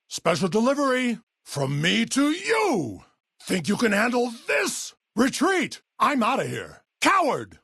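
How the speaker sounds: high, full-voiced battle taunts; combat yelling. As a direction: yell across the fight. Combat taunts and retreat calls.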